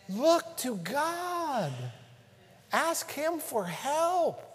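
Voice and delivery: slow, drawn-out plea; slow appeal. Real, not acted. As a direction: lay each word down slowly. Preacher slows, appealing.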